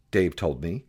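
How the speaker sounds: plain reading voice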